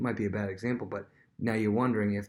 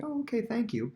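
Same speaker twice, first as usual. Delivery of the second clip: polite thanks; 12 semitones higher than usual. Retold or imitated. Polite reply to flattery.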